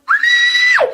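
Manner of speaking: sudden, very loud, high scream of terror; a woman screaming as the intruder comes up the stairs; terrified scream. Acted. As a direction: scream as the footsteps reach you. Killer coming up the stairs.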